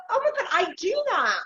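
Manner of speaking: high, bright gasp of recognition; suddenly seeing herself in it; surprised realisation. Retold or imitated. Guest recalling watching Matchmaker, mock surprise. Realising thought voiced aloud.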